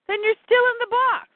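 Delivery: high-pitched acted insistence; exasperated argument. Barda argues with Scott mid-fight.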